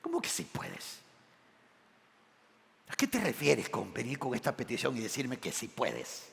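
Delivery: low, soft mock disbelief; quiet Jesus. Jesus answering the doubting father: low, soft disbelief. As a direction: let it drop low and quiet in gentle rebuke. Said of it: "Esa es la respuesta de Jesús".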